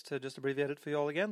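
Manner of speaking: normal talking voice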